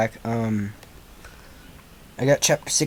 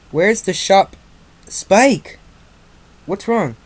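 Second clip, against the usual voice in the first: high, louder call of alarm; higher-pitched worried questioning; 7 semitones higher, 5 dB louder, slower than usual. Acted. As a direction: raise the voice in sudden concern. Voices Twilight's alarm at Spike.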